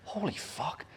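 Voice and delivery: soft, slightly high awed swearing; hushed amazement. Acted. Looking back on a good quarter, hushed amazement. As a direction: breathe it out in quiet disbelief. Acts out quiet amazed realisation.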